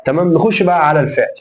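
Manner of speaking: ordinary talk, nothing marked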